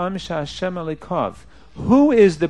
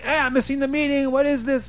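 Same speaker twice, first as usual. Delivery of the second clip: higher, imitating an upset man stuck in shul; mock upset; 8 semitones higher than usual. Rabbi acts out a businessman getting upset, mock upset. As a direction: act out a man fuming over the missed meeting. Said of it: "You can get really upset".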